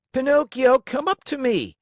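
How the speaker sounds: high, loud call across the theater; shout. A narrator's reading. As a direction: call him up to the stage. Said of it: "shouted Harlequin".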